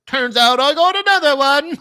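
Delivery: high, loud silly British voice; mock fantasy character. Wizard revealing a spare staff, gleeful mock voice. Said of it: "And they go".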